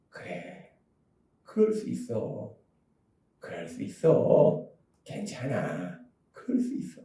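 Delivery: slow, soft near-whisper of comfort; gentle whisper. Pretend. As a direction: breathe the comfort very slowly and softly. Jesus comforting the weak. (아주 느리고 작게 속삭이는 위로)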